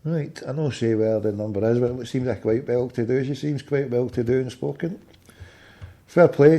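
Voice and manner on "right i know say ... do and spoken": talking to himself. Real, not acted. Canvasser musing to himself after a call, quiet aside.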